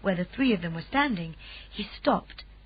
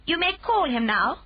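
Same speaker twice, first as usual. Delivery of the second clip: a lightly voiced calm Queen, higher; gracious royal command, a touch higher; 7 semitones higher, 4 dB louder than usual. A narrator's reading. Queen giving permission.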